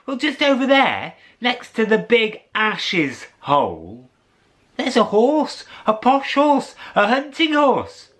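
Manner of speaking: put-on excited girl. Jill voiced excitedly.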